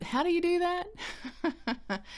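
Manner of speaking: high, slow, awed question; imitating a friend's astonished high voice; mock-amazed friend asking how. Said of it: "and she said".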